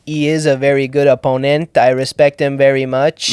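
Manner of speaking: put-on a lightly done Quebecois French accent; French Canadian accent. Impersonating George Saint Pierre praising an opponent, in a light Quebecois accent. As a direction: talk like a polite French Canadian fighter. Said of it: "George Saint Pierre's French accent".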